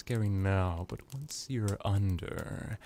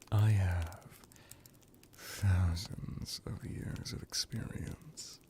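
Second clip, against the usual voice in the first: seductive promise. Acted. Vampire promising pleasure to captive.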